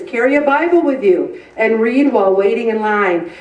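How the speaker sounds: normal talking voice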